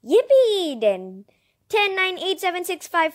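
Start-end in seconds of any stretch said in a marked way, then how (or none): 0.1-0.8 high, loud yippee; gleeful little shout; excited cheer. Real, not acted. Cheering the butterflies' return.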